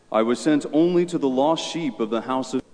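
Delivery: normal talking voice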